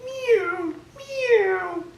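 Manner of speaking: mock cat meow, very high. A narrator's reading. Cat meowing.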